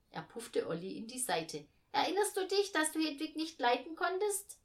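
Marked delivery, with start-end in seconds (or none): 1.9-4.6 put-on high, loud child voice; needling kid. A narrator's reading. Boy reminds brother of disliking Hedwig, loud and needling. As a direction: prod a brother with the question, pitched up.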